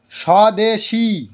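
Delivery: Swadeshi drawn out very slowly and clearly; teacher modelling swadeshi slowly for the class; slow careful example. Shown as a demonstration. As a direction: say swadeshi slowly so pupils hear each part. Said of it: "এটা ভালো করে বোঝো".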